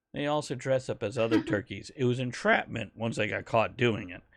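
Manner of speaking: ordinary talk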